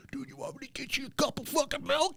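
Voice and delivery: high, strained put-on voice; imitated buddy. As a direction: mimic a friend in a squeezed high voice. Voices his buddy offering milk.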